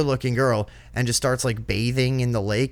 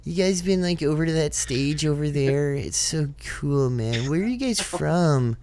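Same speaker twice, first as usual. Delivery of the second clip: festy voice; 4 semitones higher than usual. Retold or imitated. Mocking festival guys asking strangers for a lighter, festy voice. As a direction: put on a mock festy guy, high and slow. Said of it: "had that, like, festy voice".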